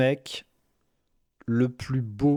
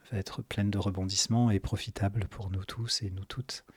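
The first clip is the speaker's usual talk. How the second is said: whisper; 5 semitones lower, 7 dB quieter than usual. Acted. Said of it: "ends in whisper".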